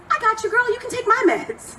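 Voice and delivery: high, bright lightly voiced neighbor; cheerful neighbor. Neighbor offering her own meds to the comic, high and easy. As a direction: chirp it like a breezy friend. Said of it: "she goes".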